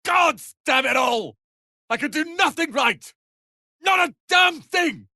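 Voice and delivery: yelling in self-reproach; anguished shout. Acted. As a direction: rage at yourself. Self-blaming outburst.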